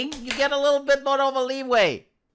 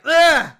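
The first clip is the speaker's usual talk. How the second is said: loud, high "ugh" of disgust; appalled groan; 6 semitones higher, 8 dB louder than usual. Retold or imitated. Reacting to the party he saw, loud disgusted groan. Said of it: "He was appalled".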